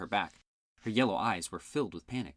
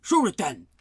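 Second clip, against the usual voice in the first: shouted order; 7 semitones higher, 7 dB louder than usual. A narrator's reading. Order during monster attack.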